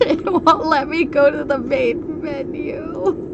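high-pitched, breaking into laughter; helpless laughing. Real, not acted. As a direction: squeal it through desperate laughter. Laughing helplessly at being stuck.

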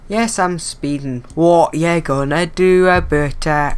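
put-on a lightly done Scottish accent taunting the police; bad Scottish accent. Train driver taunts about speeding in a bad Scottish accent. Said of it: "doing a bad Scottish accent".

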